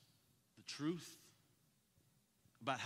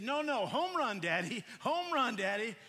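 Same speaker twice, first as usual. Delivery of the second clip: put-on high, loud childish insisting; stubborn shout; 6 semitones higher, 7 dB louder than usual. Son arguing back with his dad, loud and high.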